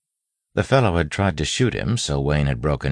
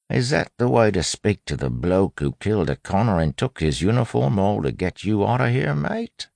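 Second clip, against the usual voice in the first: put-on higher-pitched fluid street accent; bandits' street accent; 7 semitones higher than usual. A narrator's reading. Wayne posing as the bandit's ally, bandits' street accent. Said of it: "natural fluid accent that the bandits had been using".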